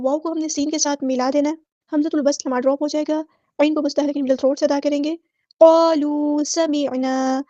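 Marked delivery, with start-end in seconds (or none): 5.6-7.4 क़ालू with heavy qaf, समिअना drawn out; slow recitation. Shown as a demonstration. Teacher reciting to class, slow chanted. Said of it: "अलिफ मद आ गया तो मद इसलिए करेंगे".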